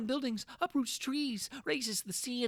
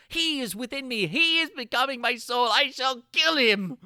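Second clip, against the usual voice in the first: rising fury; 4 semitones higher, 7 dB louder than usual. Acted. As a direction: spit out the threat. Narrator resolves to kill creature.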